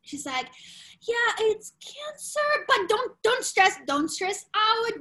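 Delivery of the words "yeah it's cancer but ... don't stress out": calm, positive doctor. Retold or imitated. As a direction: break bad news as kindly as possible. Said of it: "calm and positive about it".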